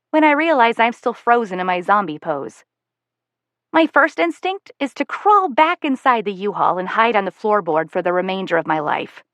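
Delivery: ordinary delivery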